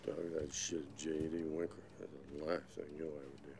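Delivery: a quiet deadly warning, barely voiced; husband's last threat to his wife, very soft and fast; soft menacing threat.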